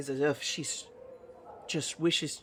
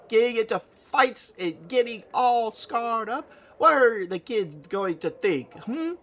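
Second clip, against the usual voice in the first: mock indignant scolding; 7 semitones higher, 4 dB louder than usual. Nanny play-acts scolding player for late nights, high and indignant. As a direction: scold like a hammed-up wronged wife.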